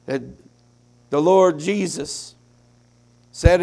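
ordinary talk

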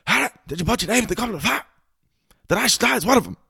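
high, fast, frantic parody voice; mock Dumbledore. Mocking the Goblet of Fire scene, squeaky and fast. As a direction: blurt it out in a squeaky panic. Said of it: "when he's like".

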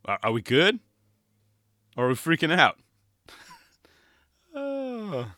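usual speaking voice

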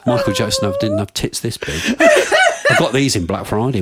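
laughing through the words. Real, not acted. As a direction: talk while giggling. Laughing about her outfit.

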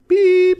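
high loud beep; voicemail beep. Pretend. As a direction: beep like an answering machine.

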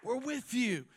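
fiery preacher cadence; preaching at full force. Acted. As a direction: drive it home to the church.

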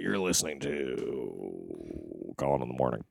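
low, smooth morning-show DJ; radio DJ voice. Pretend. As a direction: purr the station ID like a DJ. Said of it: "Radio Guy voice".

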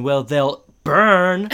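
slow, loud sarcastic sulk; sulking Corey. Retold or imitated. Corey sulking to Topanga about his food. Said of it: "And Corey goes".

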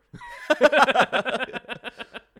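high loud laugh. Real, not acted. Big laugh.

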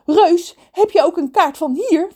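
high, loud, anxious question; nervous boy's voice nearly cracking; tense, cracking voice. Acted. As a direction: let tension push the voice up. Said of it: "Zijn stem slaat van de spanning een beetje over".

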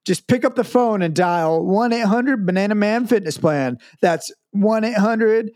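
high, hyped workout-tape pitchman; fake fitness infomercial. Host pitching a banana fitness plan to listeners, hyped infomercial voice. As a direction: sell the exercise tape like a cheesy TV ad.

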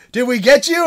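high, loud call to a hidden player; imitated calling out; retold shouted question. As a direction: holler it across the woods. Enemies call out to him.

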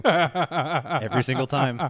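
loud, slow words through laughter; laughing. Real, not acted. Laughing at the repeated weirdness.

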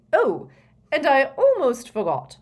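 high, loud sudden afterthought. A narrator's reading. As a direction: toss it in loudly, just remembering. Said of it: "Fudge had added".

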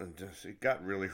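ordinary talk

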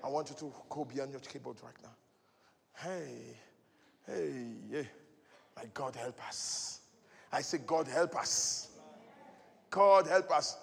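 whispered-sounding prayer to God; hushed prayer. Acted. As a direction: breathe the prayer out quietly.

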